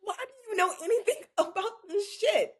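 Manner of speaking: high, laughing incredulous questions; laughing disbelief. Real, not acted. Teasing her friend, high and laughing the whole way. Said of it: "I'm trying to think".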